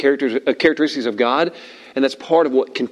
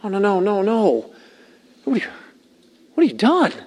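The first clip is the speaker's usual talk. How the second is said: high, pained dismay; 6 semitones higher than usual. Shown as a demonstration. God just showing up to Cain, high dismayed voice. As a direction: pitch climbing in worried disbelief. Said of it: "what the tone of his voice was".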